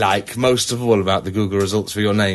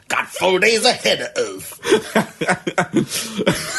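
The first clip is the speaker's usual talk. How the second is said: silly drawn-out voice; 7 semitones higher, much slower than usual. Pretend. Slow silly voice.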